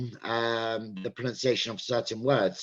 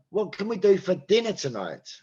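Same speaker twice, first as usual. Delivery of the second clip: "dinner" punched higher; stress on "dinner"; 6 semitones higher than usual. Shown as a demonstration. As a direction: make "dinner" the key word of the question. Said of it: "depending on where you want to stress".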